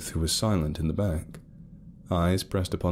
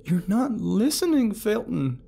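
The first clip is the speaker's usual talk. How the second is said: higher, slow pleading; Carol pleading; 3 dB louder, slower than usual. A narrator's reading. Carol begging Ruth to tell the dream, pleading. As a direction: beg her to speak up. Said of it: "Carol chimed in".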